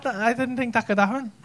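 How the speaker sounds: ordinary delivery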